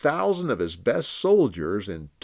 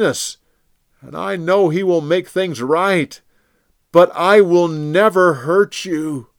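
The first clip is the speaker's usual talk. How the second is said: high, loud, earnest appeal; David pleading with King Saul from afar; impassioned plea; 9 semitones higher, 9 dB louder than usual. Acted. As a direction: plead your innocence with all your heart.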